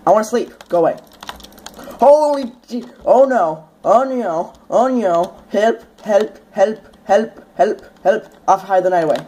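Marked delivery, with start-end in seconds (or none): 2.0-8.3 mock panic. Mobbed at night, mock panic.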